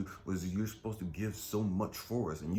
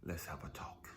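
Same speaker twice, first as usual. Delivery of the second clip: God's tender whisper; 5 semitones higher than usual. Pretend. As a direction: whisper like a comforting father. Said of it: "I can hear God say".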